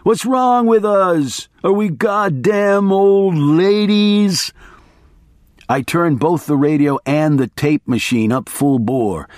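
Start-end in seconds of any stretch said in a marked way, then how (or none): 0.0-4.5 slow, high, drawn-out mock outrage; ranting attorney. Attorney's slow high rant at his friend. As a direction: stretch every word like a wild man.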